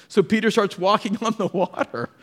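ordinary talk, nothing marked

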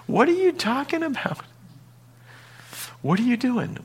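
high-pitched baffled onlooker; incredulous questioner. Pretend. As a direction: squeak out the disbelief.

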